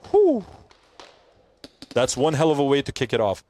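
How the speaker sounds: ordinary delivery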